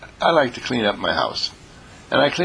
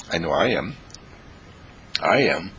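ordinary delivery

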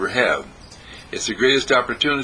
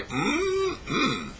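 a soft, high "mm-mm" of pleasure; high appreciative hum; 6 dB quieter than usual. Retold or imitated. Tasting Sylvia's eggs at breakfast, high appreciative hum. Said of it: "Or I just say".

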